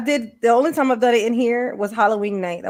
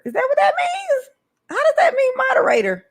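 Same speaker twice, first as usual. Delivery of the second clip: high incredulous; 14 semitones higher than usual. Real, not acted. Puzzling over a badge.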